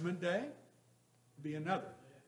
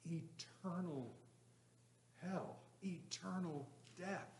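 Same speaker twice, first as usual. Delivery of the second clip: hushed solemn; 3 semitones higher, 7 dB quieter, slower than usual. Acted. Preacher stresses solemn point.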